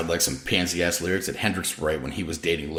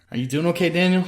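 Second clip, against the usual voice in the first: lightly voiced sick girlfriend; 10 semitones higher than usual. Chelsea voiced, high.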